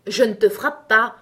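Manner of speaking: a grumpy giant growling a denial; grumbling. Acted. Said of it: "répondit le premier en grognant".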